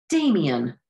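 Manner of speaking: loud shocked call of the name; exclaimed in disgust. A narrator's reading. Raja calling Damien out in disgust. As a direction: cry out his name, appalled. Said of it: "Raja exclaimed in disbelief and disgust".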